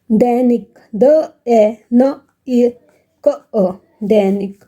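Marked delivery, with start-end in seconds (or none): 1.0-3.7 द, ए, न, इ, क, अ each said alone; sounded out separately. Shown as a demonstration. Teacher breaking दैनिक down for pupils, sounded out separately. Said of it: "हमने अलग-अलग कर दिया".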